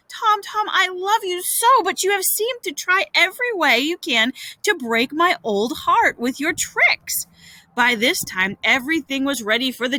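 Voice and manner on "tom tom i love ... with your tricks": high, lamenting scold; pained reproach. Acted. Aunt lamenting to the boy about his tricks, reproachful. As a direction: reproach him as if heartbroken.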